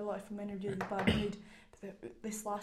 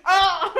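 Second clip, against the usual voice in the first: laughing yelp; 8 semitones higher, 20 dB louder than usual. Retold or imitated. Mock scream in laughing retelling.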